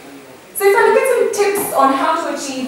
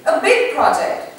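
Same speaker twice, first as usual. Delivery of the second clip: big stretched out and pushed higher; stress on big; 7 semitones higher than usual. Shown as a demonstration. Showing how big should sound: stretched "big". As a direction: make "big" sound huge. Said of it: "So big would be".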